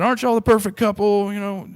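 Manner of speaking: high, bright put-on voice of a chatty lady; old lady stranger. Stranger at the bar gushing over the couple, high put-on voice. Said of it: "this lady came up and said".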